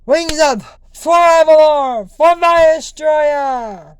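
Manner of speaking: loud, drawn-out battle cry; rally the kingdom, high and slow. Pretend. Princess rallying her people, loud slow cry.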